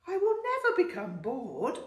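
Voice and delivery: high, loud eager squeak; squeaky voice. Acted. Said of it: "I squeaked".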